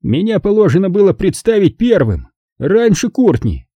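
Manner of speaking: a touch of good humour in the complaint; good-natured. A narrator's reading. Count claims first introduction, mildly good-natured. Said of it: "добродушно проговорил он".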